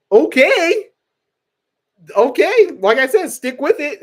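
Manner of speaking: high, loud burst of excitement; excited. Real, not acted. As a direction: squeal the okays. Excited reaction to viewer.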